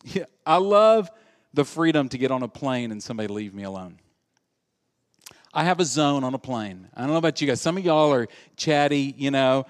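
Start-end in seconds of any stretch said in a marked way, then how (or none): none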